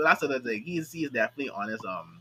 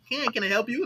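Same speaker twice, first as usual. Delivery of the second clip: customer service voice; 8 semitones higher than usual. Retold or imitated. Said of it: "the customer service was like".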